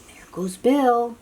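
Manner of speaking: plain reading voice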